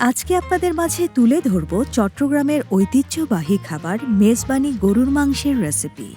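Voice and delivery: normal talking voice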